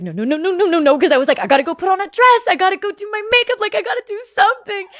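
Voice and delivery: high, fast, flustered rush; retold panic. Girlfriend realizing a proposal is coming, mock panicked. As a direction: reenact a frantic scramble, pitch climbing.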